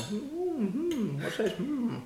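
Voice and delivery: high, soft pondering hums; mock concern. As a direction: hum thoughtfully with put-on concern. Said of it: "you do that concerned face where you go".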